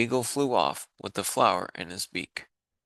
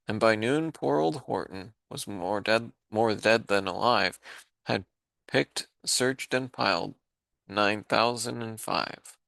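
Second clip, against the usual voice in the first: ordinary delivery, nothing marked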